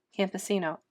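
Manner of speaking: ordinary talk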